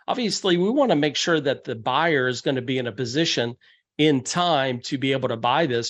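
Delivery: normal talking voice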